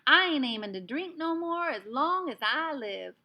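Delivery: a furious little Texan cowboy yelling; shouted Texas twang; Texas accent. A narrator's reading. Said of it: "in a strong Texas accent".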